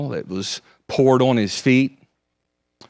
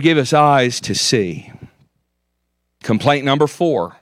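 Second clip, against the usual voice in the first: ordinary talk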